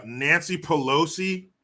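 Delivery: ordinary delivery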